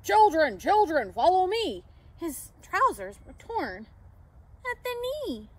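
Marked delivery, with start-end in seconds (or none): 0.0-1.8 loud rallying call; Santa calling out. A narrator's reading. Santa rallying the children to follow, loud call. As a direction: holler for everyone to follow. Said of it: "started to call".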